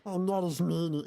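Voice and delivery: high, dorky mimic of him; mocking impression. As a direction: parrot him in a nerdy voice. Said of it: "I'm making fun of him".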